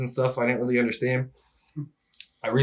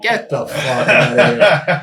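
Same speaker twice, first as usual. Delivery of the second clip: laughing disbelief; much slower than usual. Retold or imitated. Told of fraud at surgery.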